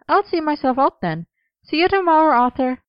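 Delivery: high, sing-out farewell; high character voice signing off; cheery goodbye. A narrator's reading. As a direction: call a friendly goodbye, pitched well up. Gwen calls out goodbye.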